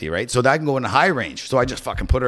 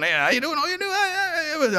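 mock flirting; 6 dB quieter, faster than usual. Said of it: "sort of flirt with the good-looking one".

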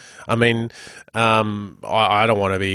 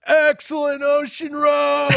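excited mock shout; slower than usual. Co-host riffing on the road name, mock-grand shout. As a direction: pitch jumps way up on excellent, hammed-up. Joking about the road's name.

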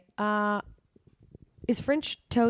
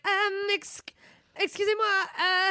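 higher, hesitant nervous bonjour; nervous French attempt; 9 semitones higher, slower than usual. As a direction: panic through the greeting. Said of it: "And I'm like".

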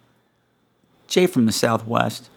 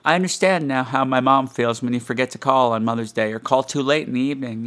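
usual speaking voice

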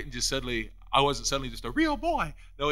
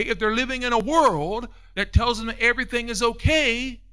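high, loud, insistent preacher delivery; impassioned preaching; 8 semitones higher, 5 dB louder than usual. Real, not acted. Preacher urging congregation to understand they don't know, impassioned preaching. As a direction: preach it urgently, pitched up and driving.

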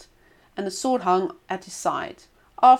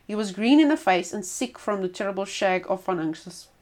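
ordinary delivery, nothing marked

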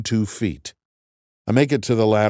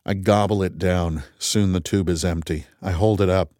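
ordinary delivery, nothing marked

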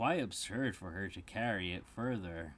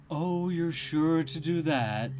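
raised, loud talking-cat voice; teasing storybook cat; Cheshire Cat; 9 semitones higher, 10 dB louder than usual. A narrator's reading. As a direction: toy with Alice, higher and louder. Cheshire Cat answers Alice.

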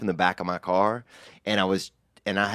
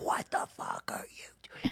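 hushed slow whisper; 13 dB quieter, slower than usual. Retold or imitated. Naked friend whispering to him over the horn. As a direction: whisper it urgently in the dark. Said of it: "He's trying to be all quiet".

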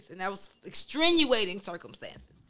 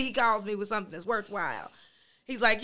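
ordinary delivery

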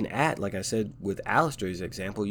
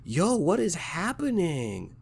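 high, slow, incredulous mock confusion; a confused fan asking why he's crying; baffled viewer; 7 semitones higher, slower than usual. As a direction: stretch out the disbelief, higher. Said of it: "It's like".

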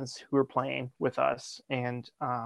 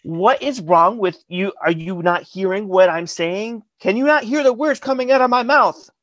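rising, heated disbelief, hammering each question; exasperated; 6 semitones higher, 4 dB louder than usual. Retold or imitated. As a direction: scold someone who won't listen, building higher and louder. Retold exasperated phone argument.

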